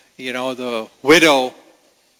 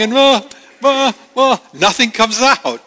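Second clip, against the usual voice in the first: put-on high, halting uh uh like a sobbing child; imitating stammering toddler; 9 semitones higher, 4 dB louder than usual. Toddler stuck sobbing to his mom, halting high voice. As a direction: mimic a toddler who can't get words out.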